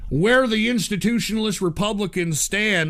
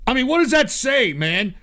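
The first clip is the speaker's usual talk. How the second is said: ordinary delivery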